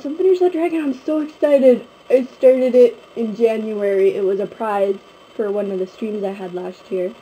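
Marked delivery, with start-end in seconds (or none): none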